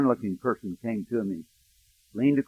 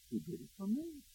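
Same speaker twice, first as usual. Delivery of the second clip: much softer, higher, voice nearly breaking; breaking whisper; 10 semitones higher, 17 dB quieter than usual. Retold or imitated. Pastor echoing her words, fading into a tearful hush. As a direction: let it fade into tears, hushed. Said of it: "she wept".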